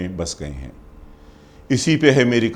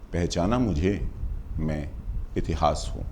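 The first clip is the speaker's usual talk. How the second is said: low, soft poetic aside with pauses; hushed refrain; 5 semitones lower, 9 dB quieter than usual. Acted. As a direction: drop right down and let each phrase hang. Soft refrain of recited poem.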